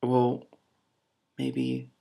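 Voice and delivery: ordinary delivery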